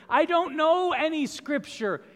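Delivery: high, loud, lost little voice of the thief; bewildered thief. Acted. Thief tells the angel he doesn't know, high and bewildered. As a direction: play the clueless thief at heaven's gate.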